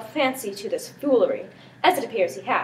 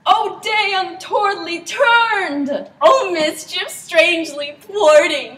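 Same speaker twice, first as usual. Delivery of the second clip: slow, high, louder wailing lines; anguished lament; 6 semitones higher, 6 dB louder, slower than usual. Acted. Prince lamenting the ruined wedding, anguished. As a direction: mourn the day as if struck.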